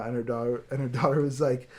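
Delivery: ordinary talk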